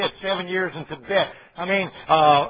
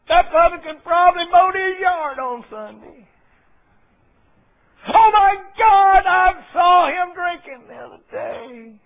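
high, loud, scandalized tattletale voice; hammed-up gossip shocked at a sinner; self-righteous Pharisee; 11 semitones higher, 5 dB louder, slower than usual. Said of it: "what the Pharisee said".

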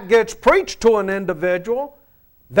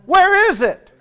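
higher, louder, with a laugh, pressing for an answer; prodding repeat; 10 semitones higher, 4 dB louder than usual. Real, not acted. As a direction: push the room to answer. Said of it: "I didn't hear that one".

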